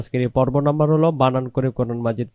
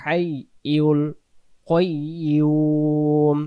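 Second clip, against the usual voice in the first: slow chanted recitation, long drawn vowels; Quran recitation; 3 semitones higher, much slower than usual. Shown as a demonstration. Teacher reciting Ayatul Kursi to students, slow chant. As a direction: chant each word slowly and melodiously.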